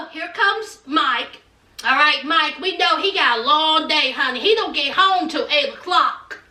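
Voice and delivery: ordinary talk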